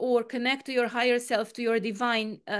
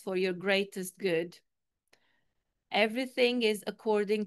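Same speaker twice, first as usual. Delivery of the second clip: guided meditation; 3 semitones lower than usual. Acted. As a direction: calmly guide them into stillness.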